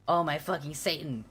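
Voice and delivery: ordinary delivery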